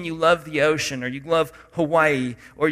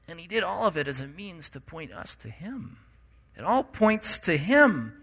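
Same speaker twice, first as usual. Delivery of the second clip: usual speaking voice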